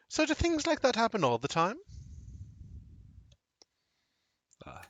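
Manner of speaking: high, lilting character voice; high-voiced inquisitive woman; curious tea-drinking stranger. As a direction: ask curiously, pitched well up.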